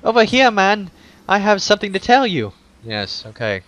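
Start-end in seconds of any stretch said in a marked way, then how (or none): 0.0-2.5 put-on higher, eager girl's voice; young girl. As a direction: pipe up like an excited child.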